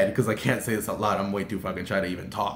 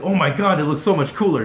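higher, louder fangirl gush; excited gushing; 5 semitones higher, 6 dB louder than usual. Retold or imitated. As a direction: gush loudly over your idol's look. Gushing over idol's shoes.

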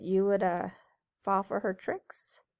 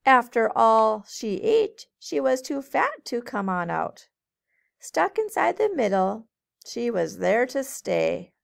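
lilting storybook read-aloud; reading to children; 5 dB louder than usual. A narrator's reading. Teacher reading a rhyming book to kids, lilting. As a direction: read it brightly to a group of kids.

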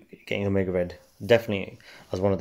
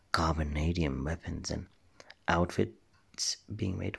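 quiet character; 3 semitones lower, 7 dB quieter, slower than usual. Acted. Character whisper trailing.

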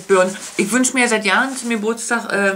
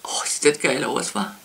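ordinary delivery

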